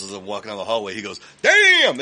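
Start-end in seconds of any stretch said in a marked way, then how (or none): 1.4-2.0 sudden yelp, much higher and louder; startled shout. Retold or imitated. Scarface spotting the mail, startled shout. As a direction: jump back with a loud cry.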